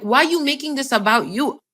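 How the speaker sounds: retold irritated complaint; pissed-off retort. Daughter confronts mother over the quarrel, pissed-off retort. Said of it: "now I'm pissed 'cause I'm like".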